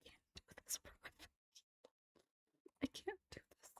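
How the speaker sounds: breathless scared whisper, barely voiced; frightened whisper. Real, not acted. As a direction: hush it like you can't bear to watch. Listener overwhelmed by the scene.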